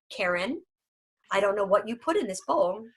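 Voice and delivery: a picky dog judging its owner's bowl; voicing the dog. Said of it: "Your dog's gonna look at you and be like".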